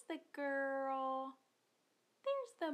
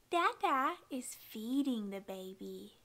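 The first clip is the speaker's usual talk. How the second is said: ordinary talk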